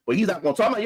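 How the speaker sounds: ordinary delivery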